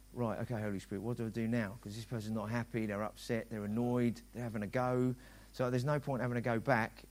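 hushed inner voice; quiet inner prayer. Retold or imitated. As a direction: pray it under your breath. Inner prayer in his head.

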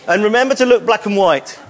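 ordinary delivery

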